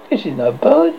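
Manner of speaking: mildly scared voice; a touch of fear. A narrator's reading. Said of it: "he said in a scared voice".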